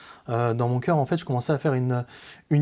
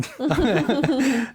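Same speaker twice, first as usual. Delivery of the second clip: laugh; 11 semitones higher than usual. Real, not acted. Laughs at own violent thought.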